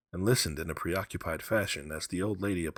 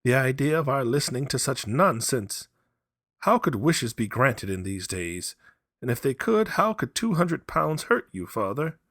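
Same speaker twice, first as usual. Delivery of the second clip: higher, louder scoffing wife; old mother scoffing at her husband, higher; lightly voiced old wife; 8 semitones higher, 6 dB louder than usual. A narrator's reading. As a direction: brush off the soldier's tales.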